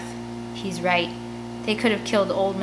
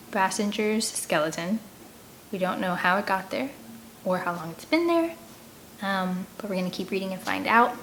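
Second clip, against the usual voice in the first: ordinary delivery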